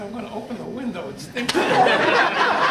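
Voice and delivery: laughing through punchline. Real, not acted.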